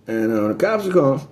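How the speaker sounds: ordinary talk, nothing marked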